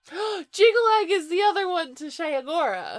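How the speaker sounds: high, loud excited surprise; gasp, then blurt out the discovery; gasping realization. Real, not acted. Gasps at the revelation.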